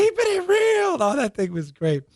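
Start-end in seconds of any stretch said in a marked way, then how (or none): 0.0-1.0 much higher, punchy comic-sketch delivery; Chris Rock catchphrase. Retold or imitated. Host quoting the comedy sketch catchphrase, high and punchy. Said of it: "Remember that Chris Rock segment?".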